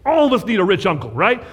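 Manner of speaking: ordinary delivery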